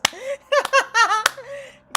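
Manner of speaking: high, loud real laughter; loud burst of laughter. Real, not acted. Streamer cracking up at a chat joke, loud high laugh.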